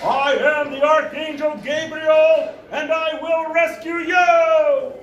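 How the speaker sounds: high, slow, drawn-out shout; deranged prophet crying out to the world; self-proclaimed archangel. Retold or imitated. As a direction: bellow a final grand proclamation. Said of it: "And he goes".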